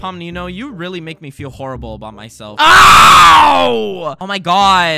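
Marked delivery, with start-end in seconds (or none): 2.6-4.2 loud high yelp; pained yelp; pained yell. Acted. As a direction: yell as you fall. Pushed off a cliff.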